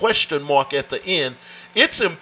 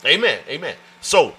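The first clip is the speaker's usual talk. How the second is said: usual speaking voice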